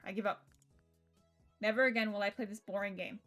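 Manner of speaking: ordinary delivery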